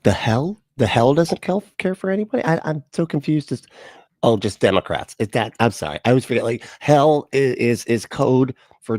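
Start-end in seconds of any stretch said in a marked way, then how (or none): none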